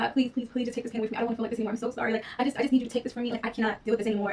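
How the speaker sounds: fast, rushed pleading, voice up a little; retold pleading prayer. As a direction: rattle off a desperate prayer, lightly acted. Retold desperate prayer on the floor.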